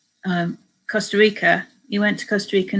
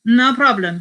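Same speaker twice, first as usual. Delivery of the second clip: louder, lightly attempted Spanish accent; amateur Spanish accent; 4 semitones higher, 8 dB louder than usual. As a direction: toss it off in a rough Spanish accent. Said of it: "doing a Spanish accent".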